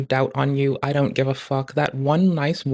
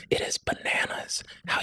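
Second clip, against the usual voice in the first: whisper; 10 dB quieter than usual. Real, not acted.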